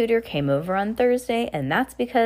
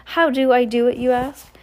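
mildly higher grand movie star; 5 semitones higher, 4 dB louder than usual. A narrator's reading. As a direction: play the diva for an audience. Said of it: "grand movie star voice".

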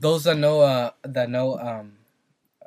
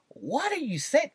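high-pitched, softer bewilderment; mock confused listener, voice shooting up; puzzled squint in the voice; baffled; 9 semitones higher, 6 dB quieter than usual. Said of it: "don't understand what they're saying".

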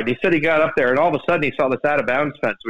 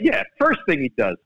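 ordinary delivery

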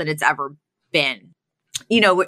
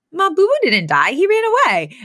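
high, louder indignant whine; protesting Fran voice; 10 semitones higher, 4 dB louder than usual. Retold or imitated. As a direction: squeal it as the upset daughter. Fran voiced correcting mother.

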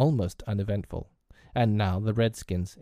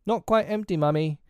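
put-on high little-boy voice; high-pitched, dutiful young son; child's answer; 9 semitones higher than usual. A narrator's reading. Child answering pretend mother.

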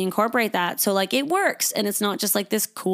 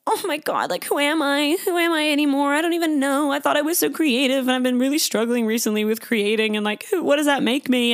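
higher, anxious self-questioning; mock crisis of identity, pitched up; panicked inner voice; 5 semitones higher than usual. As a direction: spiral into self-doubt, voice lifted. Imagined panicked self-talk.

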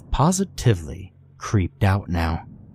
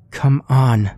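louder, higher urgent plea; urging; 5 semitones higher, 4 dB louder than usual. A narrator's reading. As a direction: urge them to hurry. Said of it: "she urged".

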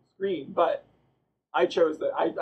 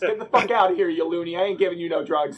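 mock dismissive shout; 4 semitones higher, 6 dB louder than usual. Acts out doctor throwing patient out.

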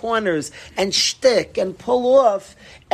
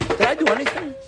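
higher, mock-innocent protest; playing innocent; 6 semitones higher, faster than usual. Husband to his mad wife, mock innocence. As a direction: feign not knowing what you did.

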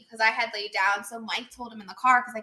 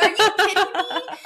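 slow, higher, laughing "Are you kidding me?"; laughing disbelief; 9 semitones higher, 6 dB louder than usual. Retold or imitated. Friend retells being woken up, laughing in disbelief. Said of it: "I was like".

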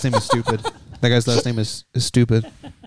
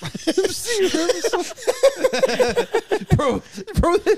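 laughing. Real, not acted. Laughing at joke.

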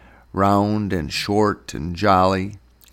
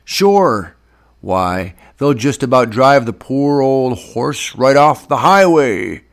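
loud, high-pitched mockery; exaggerated, jeering agreement; 4 semitones higher, 7 dB louder than usual. A narrator's reading. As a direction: heap sarcasm on a friend's boast. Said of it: "Tub jeered".

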